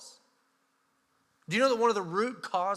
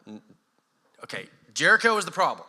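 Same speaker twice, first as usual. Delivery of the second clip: usual speaking voice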